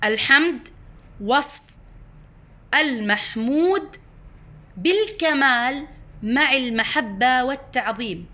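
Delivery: slow, measured, word by word with pauses; dictating a definition. Real, not acted. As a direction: spell out each phrase slowly for memorizing. (إملاء تعريف ببطء وتأنٍّ)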